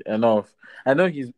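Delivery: usual speaking voice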